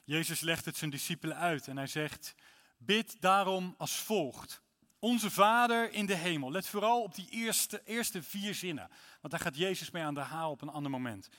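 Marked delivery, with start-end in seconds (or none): 2.8-6.5 higher, louder, slow solemn reading. Acted. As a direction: read it as scripture. Reads Matthew 6 aloud. (plechtig voorgelezen)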